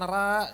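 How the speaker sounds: high, thin "ra" with a bright plain A; wrong thin ra. Shown as a demonstration. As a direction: flatten "ra" to a light A, as a mistake. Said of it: "bukan ra". ("ra" keliru, tipis dan tinggi)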